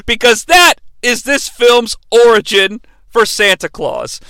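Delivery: normal talking voice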